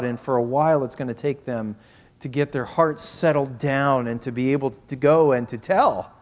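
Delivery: usual speaking voice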